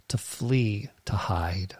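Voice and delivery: hushed whisper on run and hide. Acted. Preacher whispers to the church about hiding. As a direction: breathe the words like hiding.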